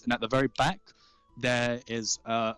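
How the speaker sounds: usual speaking voice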